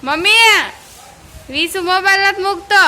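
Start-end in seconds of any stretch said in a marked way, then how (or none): 0.0-0.6 loud high call to mom; calling mommy loudly. Real, not acted. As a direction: shout for mom across the room. Child calls out to mother.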